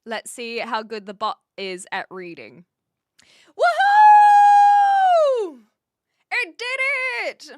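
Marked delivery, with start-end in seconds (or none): 3.6-7.5 much higher and louder shout of victory; real thrilled cheer; triumphant whoop. Real, not acted. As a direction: yell like you just won. Bot responds correctly.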